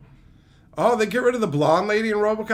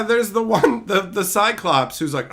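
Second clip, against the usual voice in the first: usual speaking voice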